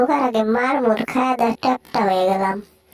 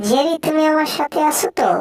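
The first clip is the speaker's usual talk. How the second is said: ordinary talk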